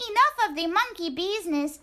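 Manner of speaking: high, fast and bossy; brisk squeaky little dog. Acted. Poquito Tito ending the fun, quick high voice. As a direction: cut the fun short.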